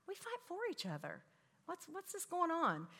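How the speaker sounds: high, lightly voiced mother correcting her kids; chiding mom. Mother chiding bickering kids, retold.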